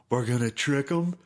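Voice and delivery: mock the corny Halloween speech; lame coach pep talk. Player mocking his coach's Halloween pep talk, hammed up. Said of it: "sucked at hype up speeches".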